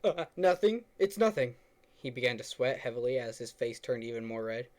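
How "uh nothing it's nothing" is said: higher, louder flustered Greg; flustered denial. Acted. Greg denying it to the girl, flustered and high. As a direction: blurt it out, caught red-handed.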